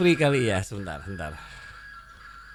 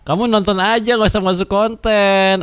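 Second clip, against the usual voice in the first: telling off; 7 semitones higher, 5 dB louder than usual. Real, not acted. Telling kid off, louder. (menegur anak dengan keras)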